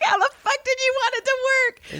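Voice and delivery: high, exasperated disbelief; high incredulous retort. Retold or imitated. Firing back at the creep in disbelief, high and exasperated. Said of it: "I'm like".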